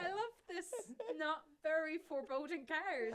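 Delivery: high, laughing gushy thanks; mock gratitude. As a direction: gush thanks through helpless laughter. Laughing mock thanks for morbid card.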